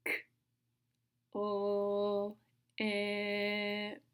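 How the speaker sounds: crisp "K", then long held "o" and "ip"; super slow sounds. Shown as a demonstration. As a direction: sound out "K, o, ip" piece by piece. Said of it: "Listen again".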